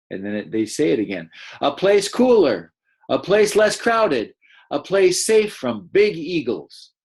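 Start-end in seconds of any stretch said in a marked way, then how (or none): 1.6-6.9 higher, louder, slower and lively; extra energy. Shown as a demonstration. Teacher reading the dassies' shout to the class, lively and loud. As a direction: read it like the dassies calling out. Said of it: "giving their voice a little extra energy".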